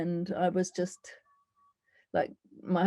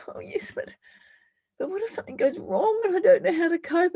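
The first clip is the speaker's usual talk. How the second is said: frightened self; 12 semitones higher than usual. Acted. Inner dialogue, her frightened self.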